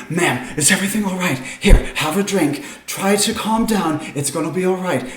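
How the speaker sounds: gently calm a hysterical stranger; concerned helper. Acted. GM voices helpers soothing her.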